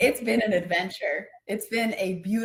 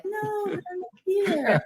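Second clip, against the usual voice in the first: high and drawn out, floating; high, slow playful voice; 13 semitones higher, much slower than usual. Retold or imitated. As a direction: sing it up high, carefree. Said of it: "I'm like".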